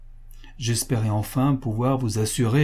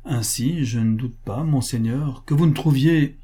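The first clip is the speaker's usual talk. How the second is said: ordinary delivery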